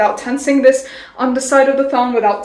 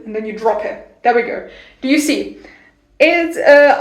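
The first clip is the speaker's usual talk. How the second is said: usual speaking voice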